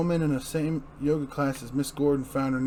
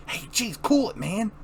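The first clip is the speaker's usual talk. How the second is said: gangster; 7 semitones higher than usual. Acted.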